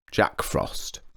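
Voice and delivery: breathy whisper of the name. A narrator's reading. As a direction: whisper it like a secret. Naming the boy mysteriously.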